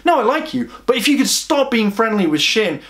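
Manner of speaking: high-pitched, mock-sweet insistence; jealous girl's reply. Acted jealous reply to teacher.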